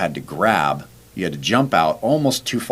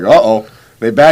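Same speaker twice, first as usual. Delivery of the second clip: teasing question; 4 semitones higher, 12 dB louder than usual. Retold or imitated. Teasing the struggling runner.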